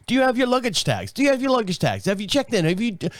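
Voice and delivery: lightly British desk agent; British accent. Retold or imitated. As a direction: put on a British clerk's accent. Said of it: "'cause she's British".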